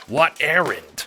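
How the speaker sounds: furious demand for answers; angry demand. Acted. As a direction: bark the question at him. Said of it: "you demand".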